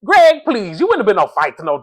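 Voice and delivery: high, exasperated "Greg, please"; incredulous scoff. Real, not acted. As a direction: brush off his boast in disbelief. Scoffing at Greg's fight claim.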